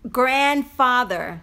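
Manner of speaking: GRANDFATHER despacio, "GRAND-fáder", con a clara; pronunciación modelo. Shown as a demonstration. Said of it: "No es green". (GRANDFATHER pausado para que lo repita la tía)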